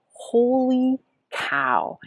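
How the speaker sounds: high, slow, drawn-out holy cow; amazed. Retold or imitated. Crossing the finish line, amazed and slow. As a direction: stretch it out in disbelief.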